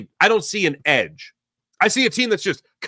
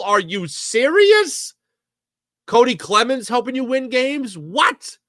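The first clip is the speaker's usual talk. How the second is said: incredulous; 4 semitones higher, slower than usual. Real, not acted. Disbelief at triple.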